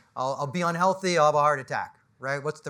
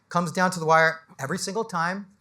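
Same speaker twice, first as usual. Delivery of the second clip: ordinary talk, nothing marked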